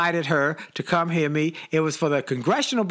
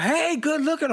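high-pitched flirty call-out; mock catcall pitched much higher; retold holler; 11 semitones higher than usual. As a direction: call it out to a stranger up the street. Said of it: "I hollered out".

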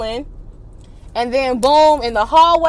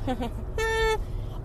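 high breathy laugh; 10 semitones higher, 11 dB quieter than usual. Real, not acted. Laughing at herself mid-story.

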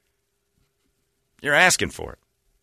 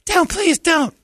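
high falsetto begging; hammed-up squeaky pleading; mock girly plea; much slower than usual. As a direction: beg in a squeaky put-on girl voice. Girl begging in pool story.

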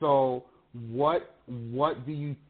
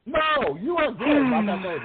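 excited; 12 semitones higher, 4 dB louder than usual. Real, not acted. Reassuring guest after her outburst.

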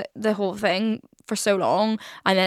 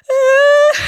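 loud, high-pitched fake wail; mock sobbing wail; 10 dB louder than usual. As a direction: burst into an exaggerated crying wail. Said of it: "just started, like, sobbing".

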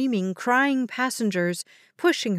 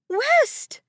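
very high mother's scream; panicked scream. A narrator's reading. Mother calling her missing son, screaming in panic. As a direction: scream for your lost child. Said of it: "panicked sound of a mother screaming".

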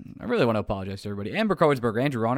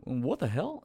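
in his tone; 4 dB quieter, slower than usual. Retold or imitated. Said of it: "I say it in his tone".

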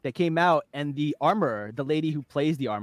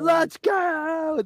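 battle cry; 11 semitones higher than usual. Retold or imitated. Quoting Bo-Katan battle cry.

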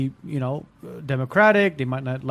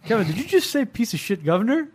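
incredulous; 7 semitones higher than usual. Retold or imitated. Incredulous retort to contractor.

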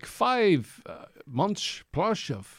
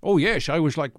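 ordinary talk

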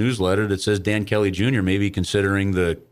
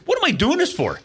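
pitched way up, incredulous; exasperated outburst. Retold or imitated. Calling Bruce after the rejection, high exasperated cry. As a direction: throw the question up in disbelief. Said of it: "I called Bruce and I said".

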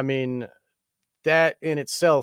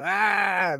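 mock scream; 8 semitones higher than usual. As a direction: squeal it up high in mockery.

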